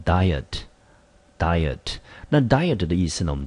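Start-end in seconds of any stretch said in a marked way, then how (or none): 0.0-1.8 diet said slowly twice, stress on the first syllable DI; slow model pronunciation. Shown as a demonstration. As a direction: spell it out slowly, diet, diet, with weight on the front. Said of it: "我们就念成diet".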